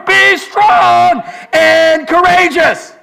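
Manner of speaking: high, slow, full shout; shouted command. Acted. Preacher shouting God's charge at the room. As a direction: bellow each word.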